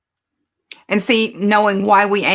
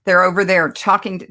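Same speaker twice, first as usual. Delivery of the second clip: ordinary talk, nothing marked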